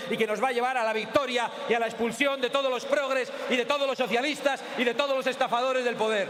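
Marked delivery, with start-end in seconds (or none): 1.7-6.3 shouted rally cry, pitched high; booming balcony speech. Real, not acted. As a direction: roar it to the crowd.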